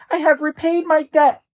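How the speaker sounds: very high, tiny mouse voice; squeaky mouse. A narrator's reading. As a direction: squeak a formal declaration. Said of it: "the mouse replied".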